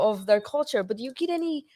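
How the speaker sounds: ordinary delivery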